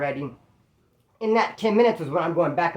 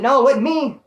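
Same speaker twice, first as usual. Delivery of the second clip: higher, louder protest of innocence; retold denial; 6 semitones higher, 6 dB louder than usual. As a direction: push the denial up and out. Retold denial to three inmates.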